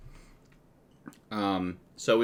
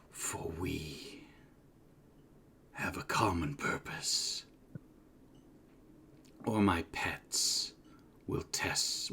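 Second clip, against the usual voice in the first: slow, soft, threatening hiss of an offer; a sinister monster bargaining in a whisper; menacing creature whisper; 9 dB quieter, slower than usual. Acted. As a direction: whisper the threat like a lurking beast. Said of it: "It looks at you and says".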